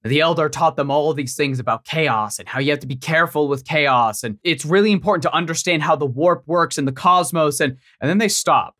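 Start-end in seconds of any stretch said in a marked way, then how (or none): none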